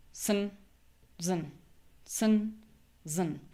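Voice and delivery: "Sun, zun" with a short schwa vowel; schwa syllables. Shown as a demonstration. Teacher modelling schwa syllables. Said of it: "Both vowels are schwa".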